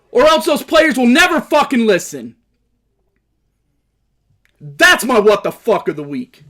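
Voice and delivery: loud, high angry shouting; furious rant. Real, not acted. Fan yelling at Steelers fans and Bengals organization, furious shout. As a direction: yell straight at the rival fans and the club. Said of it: "Do I sound fucking pissed?".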